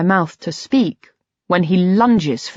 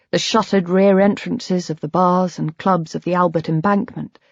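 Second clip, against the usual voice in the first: usual speaking voice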